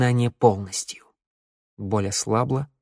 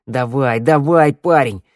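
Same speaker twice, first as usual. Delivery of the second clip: louder, pushy hurry-up; urging shout; 2 semitones higher, 6 dB louder than usual. Acted. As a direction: shove him along with your voice. Shnyryala urges Theo.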